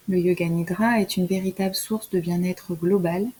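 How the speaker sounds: normal talking voice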